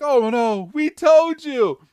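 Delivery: high, loud cartoon wail; mock alarm. Podcaster voicing Team Rocket about to be attacked, mock wail. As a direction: wail it in exaggerated dread.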